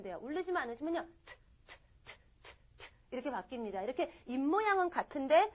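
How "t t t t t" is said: 트 as very soft puffs without voice; unvoiced t. Shown as a demonstration. Teacher showing D without vocal cords, 트 voiceless and soft. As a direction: keep the d mouth shape, release 트 as air only. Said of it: "성대만 쓰지 않으시면".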